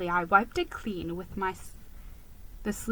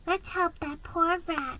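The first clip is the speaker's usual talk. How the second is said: murmur; 7 semitones higher, 5 dB quieter than usual. Acted.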